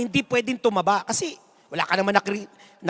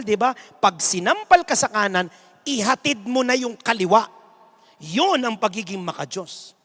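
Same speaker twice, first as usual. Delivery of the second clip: ordinary talk, nothing marked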